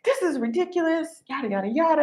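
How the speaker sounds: high, exasperated mimicry; lightly hammed-up gripe; mock complaining neighbor. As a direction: imitate the griping neighbor.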